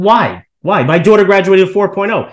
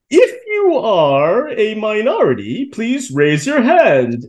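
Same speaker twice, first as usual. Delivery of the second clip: slow, higher, monotone mock speech voice; robotic college president; 5 semitones higher, much slower than usual. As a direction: drawl it out like a robot announcer. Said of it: "he goes".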